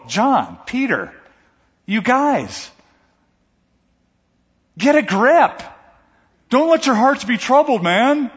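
rising to a high, loud plea; exasperated urging. Pretend. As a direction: shake them awake, half yelling at old friends. Preacher scolds disciples in upper room.

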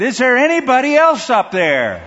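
high, loud desperate call; panicked punchline shout. Acted. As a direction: beg anyone else but God. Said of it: "the man said".

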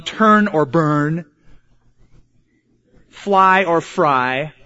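slow, drawn-out hellfire slogan; mock scare preaching. Preacher parodies scaring a person into hell, mock menace. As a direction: draw out each warning word slowly. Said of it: "scare a person into hell".

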